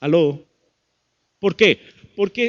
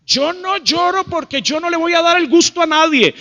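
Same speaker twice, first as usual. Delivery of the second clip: high, loud stubborn refusal; loud, raised-pitch defiance; defiant mock outburst; 8 semitones higher, 5 dB louder than usual. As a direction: act out a proud person refusing to cry. Acts out defiant person refusing tears.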